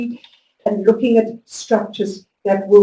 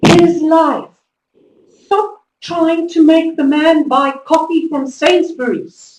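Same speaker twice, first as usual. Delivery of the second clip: louder and higher, slow and emphatic; higher, louder inner rant at herself; exasperated self-scolding; 5 semitones higher, 6 dB louder, slower than usual. Retold or imitated. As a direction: tell yourself off, slowly and firmly. Scolding herself in retold thought.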